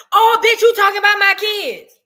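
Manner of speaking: high, loud mocking squeal of an offended mom; shrill-free high indignant mimic; mock outraged mother. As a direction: play the touchy mother screeching back.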